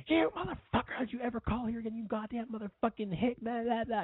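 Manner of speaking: very high, strained imitation of an enraged mother; shrieky retold scolding, trailing into blah-blah; mock furious tirade. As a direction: act out mom tearing into a caller. Mother chews out caller on phone.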